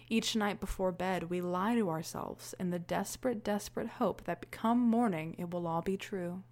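soft hushed reading of the line; quoting the monologue. Retold or imitated. Host quoting the final monologue line, soft and hushed. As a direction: read it low like a whispered confession. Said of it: "I also really love the line".

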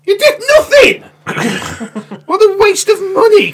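high, loud outraged yell; real outraged shout at the telly; exasperated shout. Real, not acted. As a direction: yell at the useless cop. Ranting at the TV cop.

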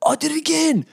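high, hammed-up comic voice; put-on voice. Podcaster slipping into a silly voice, high and hammed-up. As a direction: pitch it way up, jokey and quick.

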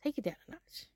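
whispered, unvoiced hush; whispered reminder from mom to calm down; mom's whisper. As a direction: hush yourself like mom would. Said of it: "like my mom says".

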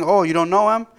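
loud, high mock-surprised question; sarcastic. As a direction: retell it with sarcastic disbelief, pitch climbing to the end. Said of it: "quite sarcastically".

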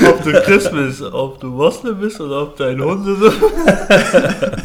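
loud, high parody of a wise saying, laughing; mock wise quote, laughing. Friend parodies Gandhi's saying, loud and laughing. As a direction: deliver a fake legend quote while cracking up. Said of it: "Wow, dieses Legendenzitat".

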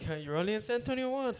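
lightly put-on Swedish accent; a hint of a Swedish tourist accent. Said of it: "Swedish Norwegian people talk like".

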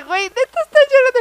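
high, loud, fast mock incredulity; retold disbelief. Woman reacting to boyfriend crying at daycare, loud disbelief. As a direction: act out astonished disbelief, half laughing. Retelling her disbelief at his tears.

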